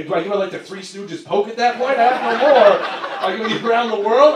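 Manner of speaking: ordinary delivery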